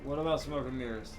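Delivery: normal talking voice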